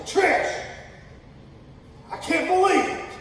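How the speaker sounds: high, loud exasperated cry to wife. Retold or imitated. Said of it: "I say".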